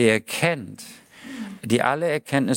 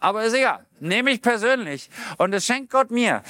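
excited; 8 semitones higher than usual. Real, not acted. Enthusiastic about God's gifts.

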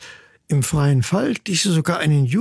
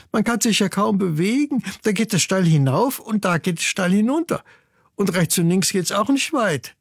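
higher voice of a complaining little sister bird; lightly voiced young chick; 6 semitones higher than usual. Sister chick agrees the ledge is too cramped, lightly complaining. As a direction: chime in as a small chick.

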